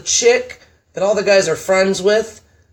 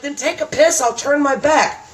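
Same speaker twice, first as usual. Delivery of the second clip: higher, sassy comeback; sassy retort; 9 semitones higher than usual. Retold or imitated. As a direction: toss it off, unbothered. Retorting to the man in the story.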